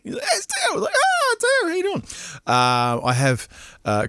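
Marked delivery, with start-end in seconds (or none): 0.2-0.7 high, squealed mock call; excited greeting. Retelling a shouted street greeting. 0.9-2.0 high-pitched, gushing recognition; delighted greeting. Retold or imitated. Acts out his delighted reply.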